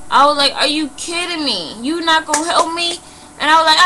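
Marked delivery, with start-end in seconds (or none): 0.5-3.0 high-pitched incredulous comeback; indignant disbelief. Retold or imitated. Offered a dollar instead of help, indignant disbelief. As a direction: retell it as an affronted, raised-pitch challenge.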